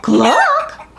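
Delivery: high questioning cluck; surprised pig. A narrator's reading. Pig hears himself cluck instead of oink, high surprised cluck. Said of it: "shot open in surprise".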